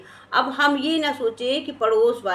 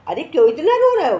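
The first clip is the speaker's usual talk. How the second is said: raised, louder, quick-fired question; acted remonstrance; 5 semitones higher, 7 dB louder, faster than usual. Neighbour asks the crying man why he weeps, raised remonstrance. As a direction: call out a brisk challenge to someone crying.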